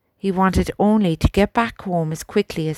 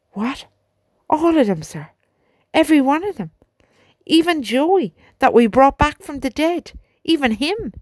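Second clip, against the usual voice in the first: higher, louder cry carried across the yard; ringing out; 8 semitones higher, 4 dB louder than usual. A narrator's reading. Albert calling out to the major across the yard, high and loud. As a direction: call out in dismay so the whole yard hears. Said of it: "Albert's voice rang out across the yard".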